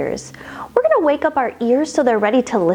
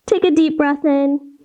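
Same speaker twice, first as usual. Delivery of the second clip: ordinary talk, nothing marked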